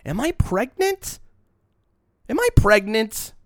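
shrill, very high mock panic, climbing up; mock shriek. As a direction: play up a fake panicked shriek. Joking about cravings.

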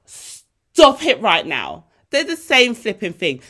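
loud, slow exasperation; exasperated outburst. Real, not acted. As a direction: drag it out, fed up. Exasperated at fashion rules.

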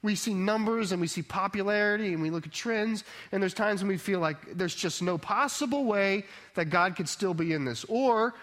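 soaring sermon voice; impassioned preaching. Acted. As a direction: preach it, building to the climax. Preaching at full force.

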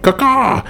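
a shrill high caw-caw like a big bird. Acted. GM sounds the creature's cry, high caw. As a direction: squawk it out high like a crow.

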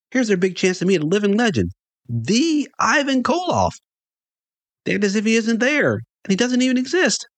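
high-pitched, indignant amazement; incredulous fan. A narrator's reading. Fan baffled that crowds ignore his idol, incredulous.

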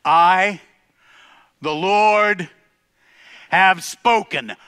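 slow, loud, drawn-out declaration; thundering decree. Acted. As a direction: let each word land like a verdict. God's decree, repeated climax.

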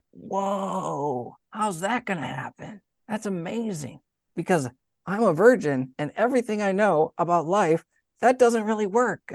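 high-pitched amazed questioning, acted out; full of wonder. Mary answering the angel's news, full of wonder. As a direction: play someone marvelling at impossible news. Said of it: "a question that's full of wonder".

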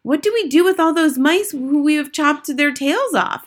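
questioning witch audience. Acted. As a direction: ask as one of an attentive crowd of witches. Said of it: "asked the audience".

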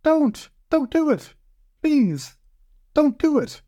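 very high-pitched little pleading voice; tiny high voice begging; tiny voice crying out. A narrator's reading. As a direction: squeak it out like a tiny desperate creature. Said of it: "a tiny voice cried out".

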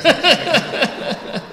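high, loud peal of laughter; real laugh bursting out high. Real, not acted. Musician caught dozing makes a joke, loud high laughter. Joking he was having a sleep.

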